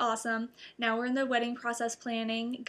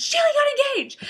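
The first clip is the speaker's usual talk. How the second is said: much higher and louder proclamation; excited announcement; 10 dB louder than usual. Retold or imitated. Relative announcing the engagement, loud high excitement. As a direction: blurt out the big news. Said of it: "somebody goes".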